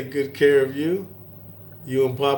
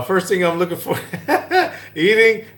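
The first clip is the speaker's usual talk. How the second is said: words broken up by high giggles; laughing; 5 semitones higher, faster than usual. Real, not acted. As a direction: crack up while trying to talk. Cracking up mid-sentence.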